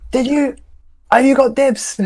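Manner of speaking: high-pitched call, softening; calling over. Pretend. Character asking Fulgrim about dibs, calling over.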